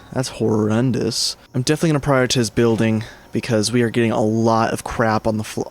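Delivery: normal talking voice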